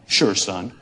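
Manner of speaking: put-on a giant man talking down to a kid; big man. Giant football player answers boy.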